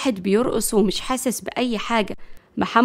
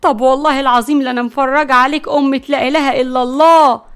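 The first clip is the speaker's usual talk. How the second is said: high, loud accusation; loud, shocked fury turning to threat; shocked outrage; 4 semitones higher, 8 dB louder than usual. Acted. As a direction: confront a cheating husband, scandalised. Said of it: "وقالت بصدمة".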